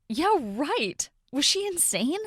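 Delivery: high, swooping scoff; sarcastic disbelief. A narrator's reading. Narrator on sister's matchmaking idea, sarcastic disbelief. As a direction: scoff at an absurd suggestion.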